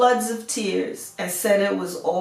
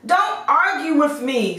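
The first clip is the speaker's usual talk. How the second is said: high, louder stern warning; impatient beast; 6 semitones higher, 3 dB louder than usual. A narrator's reading. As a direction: cut off the father's pleas. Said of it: "said the beast".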